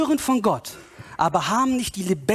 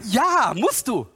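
high, insistent preacher's answer; impassioned, pitched-up insistence; emphatic rebuttal; 5 semitones higher than usual. Real, not acted. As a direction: overrule the objection with fervour. Preacher answers the imagined objector.

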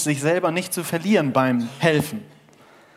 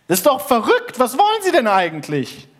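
hoch und laut, fassungslos; empört; 9 semitones higher, 5 dB louder than usual. Acted. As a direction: schrei ungläubig nach unten. Wandersmann empört am Seil. (empört gerufen, hoch und laut)

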